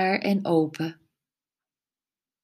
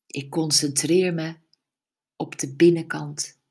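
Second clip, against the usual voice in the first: ordinary delivery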